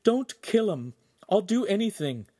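high-pitched desperate plea; captive's anxious, high entreaty; pleading. A narrator's reading. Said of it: "Giulietta pleaded".

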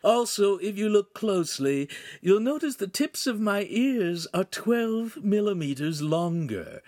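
high-voiced character with mild dejection; understated dejection in a high character voice; dejected. A narrator's reading. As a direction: list it in a high voice, sinking at the end. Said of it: "Pan said dejectedly".

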